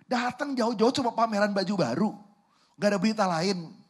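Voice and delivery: high, indignant outburst; irritated brother. Acted. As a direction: complain loudly at a show-off little brother. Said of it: "sebel nggak?".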